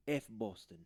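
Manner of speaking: quiet, mumbled mock Spanish accent; a deliberately bad Spanish accent as A-Rod, low and soft. Mocking A-Rod in a bad Spanish accent. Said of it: "That was a horrible Alex Rodriguez".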